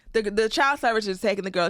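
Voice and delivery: ordinary talk, nothing marked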